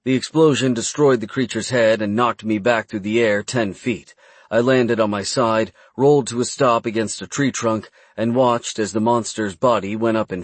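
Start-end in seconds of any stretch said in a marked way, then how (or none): none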